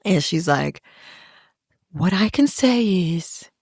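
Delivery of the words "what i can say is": slightly raised, prim and earnest; mock earnest sister; prim after-school special voice. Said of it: "after-school special face. and voice".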